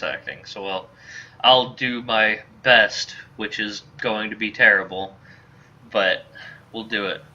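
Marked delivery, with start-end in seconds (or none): none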